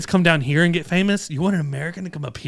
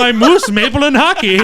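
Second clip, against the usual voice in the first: higher, louder cartoon Canadian; mock Canadian accent; 7 semitones higher, 4 dB louder than usual. Listing Canadian clichés in a higher, louder mock Canadian accent.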